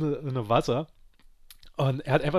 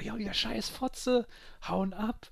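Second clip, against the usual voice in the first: much higher, slow, spitting insults; furious screech; 7 semitones higher, slower than usual. Retold or imitated. The angry coworker threatening the mockers, shrill fury. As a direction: lash out at the mockers.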